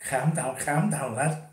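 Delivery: put-on slow, drawn-out Scouse accent; Scouse impression. Jokey caller mocking a Liverpudlian catchphrase, slow Scouse accent. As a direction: mimic a Liverpool lad telling someone to calm down, in Scouse. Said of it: "I hate the Scouse accent".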